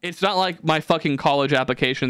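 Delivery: usual speaking voice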